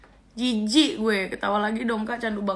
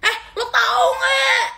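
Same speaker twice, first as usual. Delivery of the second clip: mock shriek; 13 semitones higher, 5 dB louder, slower than usual. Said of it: "Abis itu".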